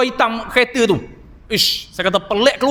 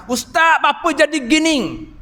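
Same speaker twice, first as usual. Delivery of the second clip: higher plea in thick Terengganu Malay; Terengganu dialect; 4 semitones higher than usual. Retold or imitated. The ustaz shows the audience how Terengganu folk ask, in Terengganu dialect. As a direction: say it the Terengganu way, bapa jadi gini. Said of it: "Orang Terengganu".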